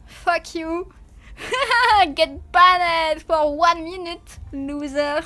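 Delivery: loud, laughing mock taunt. Real, not acted. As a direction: gloat while laughing. Playful ban, laughing.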